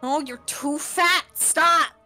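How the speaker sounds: ordinary talk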